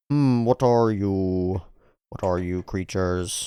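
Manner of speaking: loom over them, drawing the words out; looming creepy figure. Acted.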